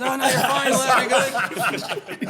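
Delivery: friendly bartender voice. Retold or imitated. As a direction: pitch it way up, all is forgiven. Voicing bartender's forgiving reply.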